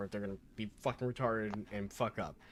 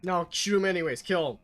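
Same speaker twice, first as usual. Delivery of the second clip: loud, raised, play-acted command; mock shouted order; 7 semitones higher, 8 dB louder than usual. Joking order to shoot the friendly anyway, mock shout. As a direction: bark it as a joking kill order.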